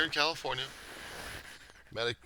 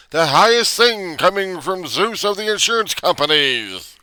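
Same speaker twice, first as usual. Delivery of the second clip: loud, higher, mock-lofty proclamation; mock grandiose; 13 semitones higher, 12 dB louder, slower than usual. Real, not acted. Mock grandeur about insurers.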